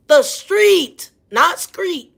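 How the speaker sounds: usual speaking voice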